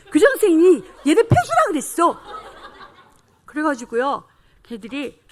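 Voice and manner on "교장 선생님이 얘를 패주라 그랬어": put-on high, loud chorus-like protest from a child; clamoring shout. Children agreeing the principal ordered it, clamoring shout. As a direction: call it out loudly, pitch jumping up.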